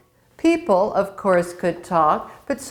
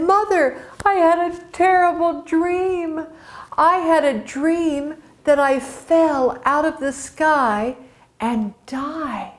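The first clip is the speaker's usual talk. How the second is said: frightened son; 7 semitones higher than usual. Acted. Balder tells mother his dream.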